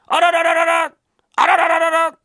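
very high, loud rattled-off passing call; shrieked rolling "ra-ra-ra" call; imitating a rickshaw man's cry. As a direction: belt out a runner's warning cry. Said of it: "声を上げんだな".

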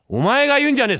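high, loud retort; father shouting at his son for quoting the old verse; father's shout. Acted. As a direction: yell it back at the cheeky kid. Father snaps at the kid.

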